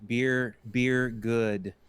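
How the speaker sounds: slow, simple caveman grunt. Pretend. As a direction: talk like a dumb caveman, slowly. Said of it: "In my best caveman beer voice".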